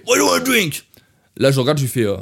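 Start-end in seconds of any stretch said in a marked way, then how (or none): 0.1-0.8 high, loud mock Irish steward; Irish accent. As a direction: ham up a thick Irish accent, much higher. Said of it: "Il me fait". (accent irlandais caricatural, aigu et fort)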